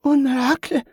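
high, astonished gasp in French. A narrator's reading. Yvette sees the dead man walking, gasping in astonishment. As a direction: gasp it out in wonder. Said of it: "Yvette gasped".

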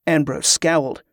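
ordinary delivery, nothing marked

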